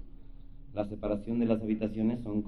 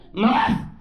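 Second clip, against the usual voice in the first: high, loud mock plea through laughter; hammed-up lusty shout; mock demand; 9 semitones higher, 10 dB louder than usual. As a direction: cry out like the woman next door. Woman demanding more through wall.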